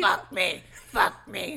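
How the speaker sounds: loud, deep possessed growl; deep demon voice. Retold or imitated. Co-host echoing the possessed girl in a loud deep growl. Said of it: "it's real deep".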